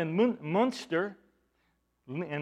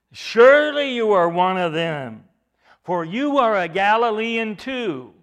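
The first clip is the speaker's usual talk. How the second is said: louder, emphatic challenge; pointed accusation; 4 semitones higher, 8 dB louder than usual. Acted. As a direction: press the charge firmly and loudly. Bystanders accusing Peter, voiced by preacher.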